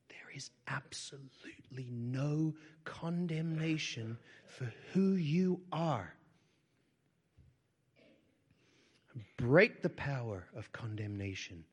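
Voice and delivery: rising from a whisper to a loud, high cry; impassioned declaration. Real, not acted. Preacher breaking condemnation over the sick, passionate. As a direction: build from hush to a ringing proclamation.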